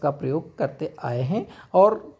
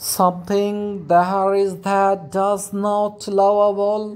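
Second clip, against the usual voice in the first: very slow, measured reading of verse; poem recitation; much slower than usual. Acted. Teacher reciting Mending Wall as example, slow poem reading. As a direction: let each line of Frost hang in the air.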